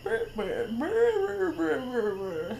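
put-on wordless old-man mumbling, rising then sinking; mocking garbled old-man noises. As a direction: imitate an unintelligible old man's babble. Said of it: "as if he sounds like an old man".

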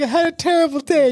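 high-pitched mock distress; mock crying. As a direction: play an upset wife, hammed up. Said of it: "telling you something and crying".

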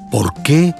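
ordinary talk

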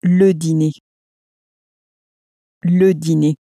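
Le dîner said with a clear é at the end, dee-nay; French dîner with final é. Shown as a demonstration. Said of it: "Sort of has an A sound on the end".

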